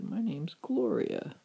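high, slow reply; gentle witch character. A narrator's reading. Witch giving the mouse her name, slow high shy voice. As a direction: introduce yourself as the softened witch.

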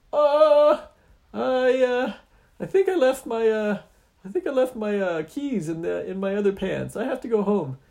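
very high, loud nervous stammer; scared stammering excuse. Acted. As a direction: fumble for an excuse in fear. Hero stalling scared.

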